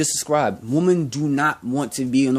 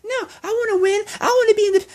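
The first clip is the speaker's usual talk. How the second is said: much higher, put-on mock voice, half laughing; mocking the women; faster than usual. Women insisting they want to win, mocked in a very high voice. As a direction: squeak it out like an outraged caricature. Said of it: "They were like".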